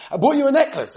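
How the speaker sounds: high, loud careless toss-off; offhand announcement. Retold or imitated. Busy husband tossing a necklace on the table, careless. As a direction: toss the line out like it's nothing. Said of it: "You just throw it on the table".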